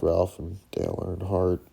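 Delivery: usual speaking voice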